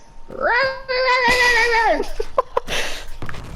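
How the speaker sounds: high, drawn-out rolling yap; long high pug bark imitation; imitated dog bark. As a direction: shriek like a furious little dog. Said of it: "That's what he sounds like when he barks".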